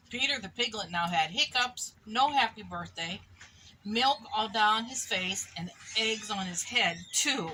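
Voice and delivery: ordinary delivery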